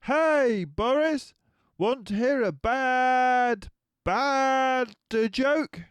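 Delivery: high, loud eager voice; eager cub mates. A narrator's reading. Cub mates trying to make Boris laugh, high and loud. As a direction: pester him cheerfully.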